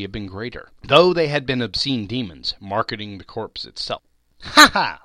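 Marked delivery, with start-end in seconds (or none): 4.4-5.0 high, loud harsh laugh; loud cackle. A narrator's reading. Charwoman counting the gains, loud high cackle. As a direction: cackle over the money. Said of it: "laughed the same woman".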